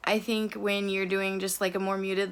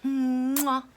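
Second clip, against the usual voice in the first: air kiss; 4 semitones higher than usual. Acted. Blowing kiss at the camera.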